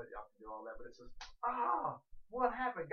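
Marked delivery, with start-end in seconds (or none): 1.4-2.9 high, loud gasp of feigned alarm; mock startled cry. Shown as a demonstration. Preacher repeating his one-handed clap demo, mock startled. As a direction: act out being taken totally by surprise. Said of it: "I'll do it again".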